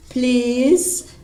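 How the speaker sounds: normal talking voice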